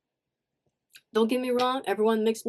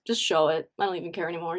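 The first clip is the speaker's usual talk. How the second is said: normal talking voice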